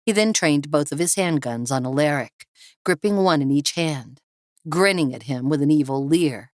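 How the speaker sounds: plain reading voice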